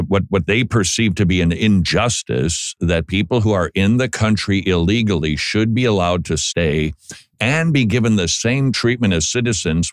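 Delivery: ordinary talk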